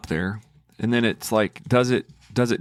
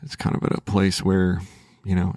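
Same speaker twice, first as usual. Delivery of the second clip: usual speaking voice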